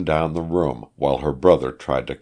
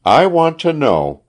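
high, louder, emphatic demand; insistent demand; 11 semitones higher, 7 dB louder than usual. A narrator's reading. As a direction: insist on an answer from the doctors. Wife demands truth from physicians.

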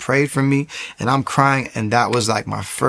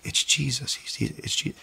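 breathless whispered realization; hushed whisper; 10 dB quieter than usual. Retold or imitated. Alone in his apartment, whispering in awe. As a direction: barely breathe the words in awe. Quiet moment of revelation.

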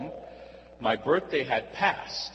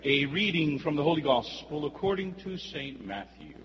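gospel reading. Acted. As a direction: proclaim it to the congregation. Priest proclaims the Gospel reading.

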